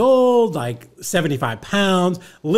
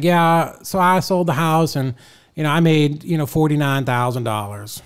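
Bob; 6 dB louder than usual. Retold or imitated. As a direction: imitate an old man proudly loud at the podium. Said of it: "talks with marbles in his mouth".

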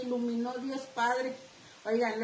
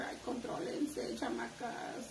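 whispered teasing aside; 9 dB quieter, slower than usual. Real, not acted. Laughing aside to the girls.

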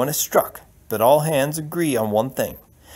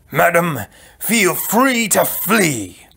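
furious roar, higher and louder; 5 semitones higher, 5 dB louder, much slower than usual. Acted. Prince dismissing the princess before the whole banquet, roaring. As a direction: roar it out in slow, heavy fury. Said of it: "Prince Humperdinck rose roaring".

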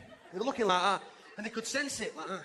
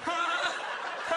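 panicking mum; 7 semitones higher than usual. Retold or imitated. Mum calling children in from the rain, high hysterical yelling. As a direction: shriek the kids indoors in a flap.

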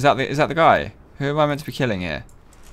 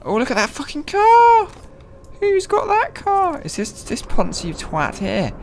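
excited shriek; slower than usual. Real, not acted. Gamer spotting a flashy car, very high excited squeal. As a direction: shriek at the car, then sneer at its owner.

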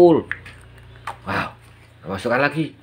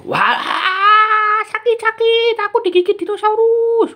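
high, hammed-up squeal of pretend pain; mock pain cry; 3 dB louder than usual. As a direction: play-act being bitten by the toy dinosaur, yelping high. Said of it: "ternyata dia menggigit".

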